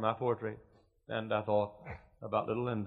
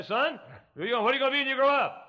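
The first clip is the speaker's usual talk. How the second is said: put-on high, raised voice to a little boy; high-pitched mock parental quizzing; father quizzing son; 14 semitones higher, 6 dB louder, faster than usual. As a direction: put the question loudly up high, like calling to a child. Acting out question to young son.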